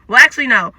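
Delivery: ordinary talk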